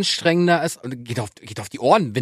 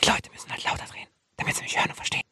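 leises, tonloses Flüstern; flüsternd weiterreden, als ginge das immer; 10 dB quieter, faster than usual. Shown as a demonstration. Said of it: "wenn du so leise flüsterst". (demonstratives Flüstern)

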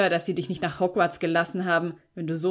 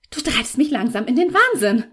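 higher, louder, strained outburst; exasperated; 4 semitones higher, 6 dB louder than usual. A narrator's reading. Girl driven mad by Felix's accusations, exasperated. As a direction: burst out at the end of patience. Quarrel at breaking point.

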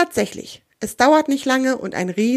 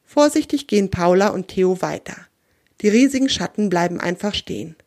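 ordinary delivery

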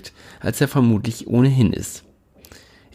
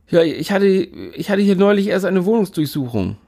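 nervous spontaneous lie; 9 semitones higher than usual. A narrator's reading.

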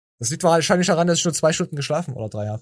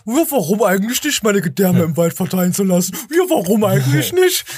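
echoing the clip in a high, loud, mocking voice; mock paranoid echo; 6 semitones higher, 4 dB louder than usual. Host mocking a listener's story, high and loud. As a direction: ham up the murder fear, high and loud. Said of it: "ich bin so paranoid".

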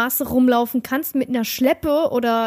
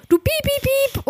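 high bleeping in place of the swear words; mock censor bleep; 13 semitones higher than usual. Bleeping herself for the edit, high mock beeps. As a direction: beep out her own insults. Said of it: "„Äh, schneid sie bitte raus".

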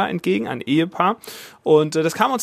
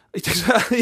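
ordinary talk, nothing marked

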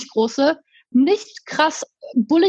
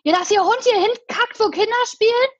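imitating the man; 9 semitones higher than usual. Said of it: "der so".

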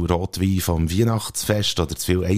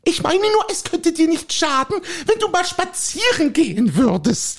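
a higher, fussy housewife voice pestering her husband; nagging wife. Acted. Wife nagging her husband to go for a walk, fussy. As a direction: play the nagging wife in a comic sketch.

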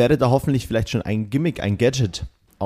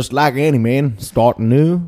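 lightly imitated Texas singsong; Texas accent; 6 dB louder than usual. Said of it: "dieser leichte Singsang und auch dieses".

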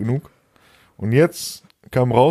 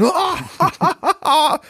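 high-pitched imitated burst of laughter; sudden high cackling laugh, put on; mocking laugh. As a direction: imitate someone cracking up, high and open. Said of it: "fängt an zu lachen".

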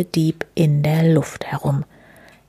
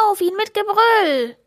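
high charging battle cry; rally the charge, voice up high; 13 semitones higher than usual. A narrator's reading. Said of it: "schreit Schnüffelo".

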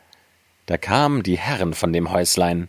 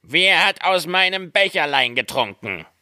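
raised-pitch dwarf voice; seventh dwarf; 9 semitones higher than usual. A narrator's reading. Seventh dwarf asks about his cup, high dwarf voice. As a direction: ask it higher, as a little dwarf. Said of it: "Der siebte".

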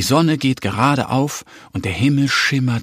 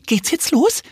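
much higher, eager duckling; excited little duck. Acted. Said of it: "fragt sie aufgeregt".

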